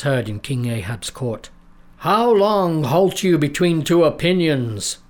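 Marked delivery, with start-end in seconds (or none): 2.0-5.0 slow, booming, raised challenge; booming prophet cry. A narrator's reading. Prophet challenging the crowd, slow booming cry. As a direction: boom it from the mountaintop. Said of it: "cried the prophet".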